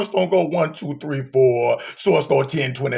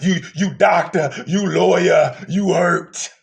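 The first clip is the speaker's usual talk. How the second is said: ordinary talk, nothing marked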